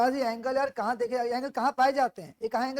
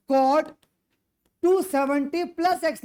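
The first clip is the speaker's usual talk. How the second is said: loud dictation; 5 semitones higher, 6 dB louder, slower than usual. Real, not acted. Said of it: "dictating a correction loudly".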